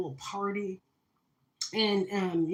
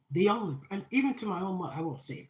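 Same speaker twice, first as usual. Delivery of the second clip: normal talking voice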